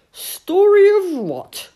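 loud, high, baffled question; hard-of-hearing badger. A narrator's reading. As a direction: pipe up like a deaf old badger. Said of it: "cupping his hand to his ear".

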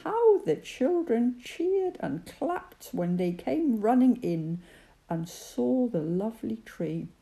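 plain reading voice

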